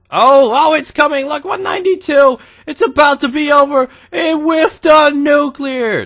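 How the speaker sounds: high, loud, rushing excitement; excited game hype. Real, not acted. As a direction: yell it like the nuke is about to drop. Game reaction, nuke about to land.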